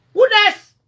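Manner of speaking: loud high who next; loud taunting challenge; shouted challenge. Real, not acted. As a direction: call out the next one. Challenging rivals.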